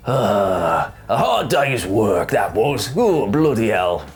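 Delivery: low, slow weary Cockney; drawn-out Cockney merchant. Acted. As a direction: sigh it out like a Cockney home from work. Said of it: "he's more of a cockney now".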